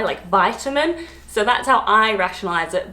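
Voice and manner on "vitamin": slow vitamin, VY-ta-min; long-i vitamin. Shown as a demonstration. Vlogger rationalising her own way, long-i vitamin. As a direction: stretch "vitamin" with an eye sound. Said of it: "it sounds like an I".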